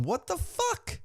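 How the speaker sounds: hammed-up squeak of bewilderment, rising sharply; mock disbelief. Fan voicing the baffled commentators, mock high-pitched disbelief. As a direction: swoop up into a comic high-pitched question.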